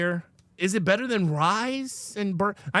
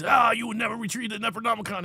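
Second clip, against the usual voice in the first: lightly voiced evil double, pitched up; a hint of a movie villain; bad self; 5 semitones higher than usual. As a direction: quote a taunting evil double. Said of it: "when he plays the bad self".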